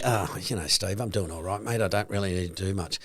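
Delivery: mock complacent owner. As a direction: lightly voice the owner who's doing all right, mate. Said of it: "And you say to me".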